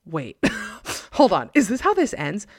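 startled, rising disbelief; surprised. Real, not acted. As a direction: caught off guard by the ending. Startled reaction to story ending.

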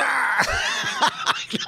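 shrieking mocking laugh; high squealing laugh. Real, not acted. Laughing at the clip.

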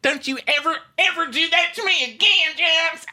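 very high, loud squeal; Brittany impression. Friend impersonates Brittany scolding Jax, very high. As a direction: shriek it high like a scolding sweet Southern bride.

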